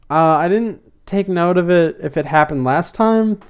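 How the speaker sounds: usual speaking voice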